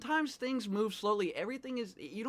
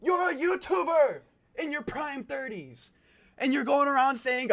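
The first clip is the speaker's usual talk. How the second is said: indignant; 6 semitones higher, 3 dB louder than usual. Real, not acted. Scolding the YouTuber.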